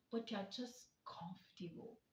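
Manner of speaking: unvoiced whispered ending. Real, not acted. Calling out comfortable listeners, whispered. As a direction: drop to a breathy hush.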